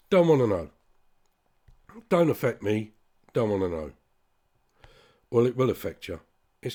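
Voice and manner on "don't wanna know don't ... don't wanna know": loud, dismissive mimicry of indifferent people; scornful mock of apathy; mocking quote. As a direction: parrot their don't-care attitude loudly. Mocking people who ignore news.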